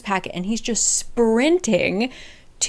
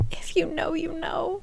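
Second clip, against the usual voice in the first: breathy whispered aside, much softer; knowing whisper; 8 semitones higher, 11 dB quieter than usual. Real, not acted. Vlogger confiding to fans in a whisper. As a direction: lean in and whisper it to the viewers. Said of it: "knowing aside whisper".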